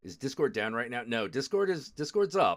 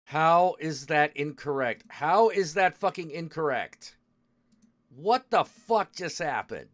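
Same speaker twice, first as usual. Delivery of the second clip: exasperated yelling; 3 semitones higher, 6 dB louder than usual. Real, not acted. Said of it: "Oh, I'm getting so angry".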